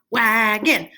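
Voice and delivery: "Wagon" in two heavy, drawn beats; stomped syllables. Shown as a demonstration. Teacher stomps the word out, "Wagon" in two heavy beats. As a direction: stomp out both parts of "Wagon". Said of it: "Stomp it".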